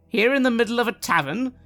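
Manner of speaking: ordinary talk, nothing marked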